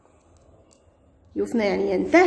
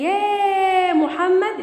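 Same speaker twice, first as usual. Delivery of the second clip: drawn-out, higher-pitched «يا» before «محمد»; calling out; 8 semitones higher, much slower than usual. Shown as a demonstration. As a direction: hold «يا» long and high, as a vocative call. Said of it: "قبلها يا نداء". (نداء بمدّ «يا» طويلًا)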